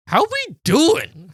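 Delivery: slow, high, sing-song fake friendliness; sarcastic sweet hello to an angry driver; mock cheery greeting. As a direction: greet him like a cheesy waiter. Mock friendly greeting to road-rager.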